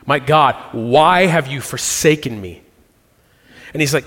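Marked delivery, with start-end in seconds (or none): none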